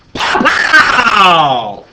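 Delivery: explosive yell to jolt the class; sudden shout. Shown as a demonstration. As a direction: bark it out like a bang. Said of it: "Did it scare you, Kevin?".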